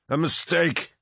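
high-pitched cry of despair; despairing roar. A narrator's reading. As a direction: roar it with the voice near breaking. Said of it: "Horus roared".

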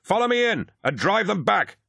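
high, sustained rallying cry; commanding yell. A narrator's reading. Rescuer driving warriors at the enemy, commanding high yell. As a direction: yell the order to charge. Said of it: "commanding and deep".

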